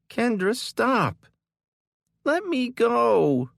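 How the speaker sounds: high, loud, slow scream; child fighting to get free. A narrator's reading. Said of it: "Kylie screamed at the top of her lungs".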